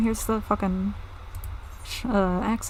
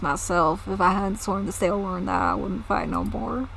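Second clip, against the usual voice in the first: put-on southern accent. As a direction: grumble about the raiders in a southern accent. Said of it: "they have fucking southern accents now".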